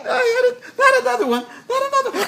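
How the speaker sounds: high, laughing hammed-up wail; mock fear. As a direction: ham up the dread of needles. Pretending to fear the needle.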